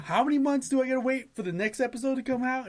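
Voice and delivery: high-pitched exasperated rant; heated complaint. Retold or imitated. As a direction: replay the indignant fan's complaint. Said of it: "I remember being heated".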